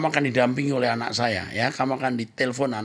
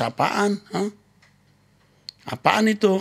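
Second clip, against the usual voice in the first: higher, incredulous brushing-off; dismissive scoff; 8 semitones higher than usual. Acted. Pastor brushing off the girl's fear with a scoff. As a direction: scoff as if the worry is silly.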